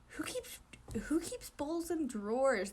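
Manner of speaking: breathy puzzled whisper. Acted. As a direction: hiss the question under breath. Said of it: "Oikawa whispered".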